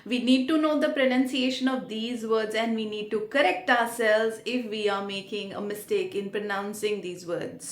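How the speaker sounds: normal talking voice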